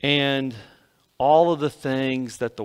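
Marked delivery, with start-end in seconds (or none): none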